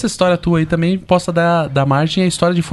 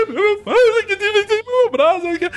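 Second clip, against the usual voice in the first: mock child crying; 13 semitones higher than usual. Boy complaining to grandma about his father, mock crying. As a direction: sob it out like a hurt five-year-old. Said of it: "eu chorando".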